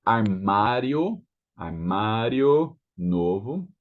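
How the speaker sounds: armário slowly, syllable by syllable. Shown as a demonstration. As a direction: spell out armário novo slowly.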